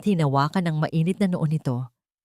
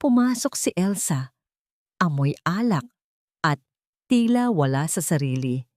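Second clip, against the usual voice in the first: plain reading voice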